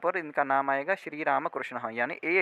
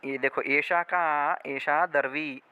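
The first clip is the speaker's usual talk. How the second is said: ordinary delivery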